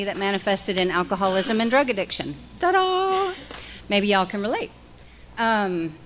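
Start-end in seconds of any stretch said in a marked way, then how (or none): 2.6-3.3 high, sing-song showman flourish; mock ta-da. Speaker undercuts her heavy confession with a playful mock flourish. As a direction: present it like a magic trick.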